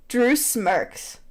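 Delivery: ordinary talk, nothing marked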